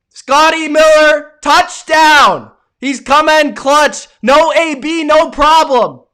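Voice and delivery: usual speaking voice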